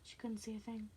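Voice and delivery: usual speaking voice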